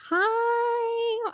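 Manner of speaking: put-on high, small child's hi; little niece voice. Niece greeting her aunt on the phone, high little-girl voice. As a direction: sound like a shy young girl calling.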